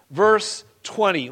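usual speaking voice

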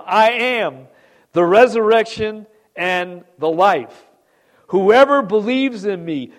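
loud, ringing scripture proclamation; preaching at full force. Acted. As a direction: proclaim it from the pulpit. Preacher proclaims Jesus' words.

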